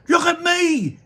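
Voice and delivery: put-on high, loud boyish yell; excited shout. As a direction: shout it while running off. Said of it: "he shouted as he ran".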